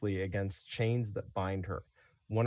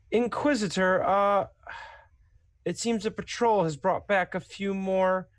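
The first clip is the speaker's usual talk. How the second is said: raised, higher voice calling to a superior; 10 semitones higher, 5 dB louder than usual. Acted. Guard announcing prisoners to the Inquisitor, calling out high and loud. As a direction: call through the doorway, hesitant after the title. Guard turns to call inside.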